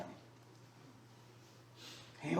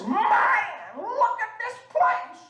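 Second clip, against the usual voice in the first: exclaiming in wonder; 6 dB louder, slower than usual. Retold or imitated. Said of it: "He says".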